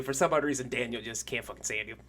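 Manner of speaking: usual speaking voice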